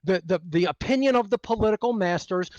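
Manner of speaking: ordinary talk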